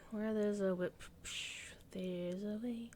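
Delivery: dropped to a whisper. Acted. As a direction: breathe the pun out in a whisper. Playful punning aside, then whisper.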